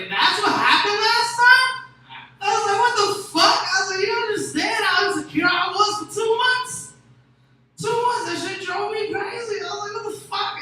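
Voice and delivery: loud, high, exasperated yelling; outraged yell. Retold or imitated. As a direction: blow up at her in disbelief. Retelling outraged reaction, loud.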